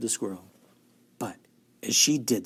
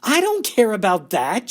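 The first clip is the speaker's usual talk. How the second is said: high loud bratty retort; shout; 10 semitones higher, 8 dB louder than usual. A narrator's reading. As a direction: snap back like a spoilt girl. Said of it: "shouted Veruca".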